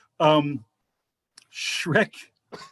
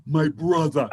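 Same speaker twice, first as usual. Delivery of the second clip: imitating the cab driver; 2 semitones higher, 3 dB louder than usual. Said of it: "He goes".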